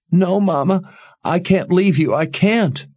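high, tearful pleading; high tearful daughter refusing; sobbing plea. Acted. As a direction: beg through tears. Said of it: "could only sob in agony".

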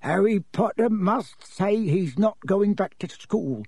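high, slow elf ultimatum; high-pitched, pleading elf; insistent house-elf. Acted. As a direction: insist gravely in a tiny creature's voice. Dobby bargains in figure voice.

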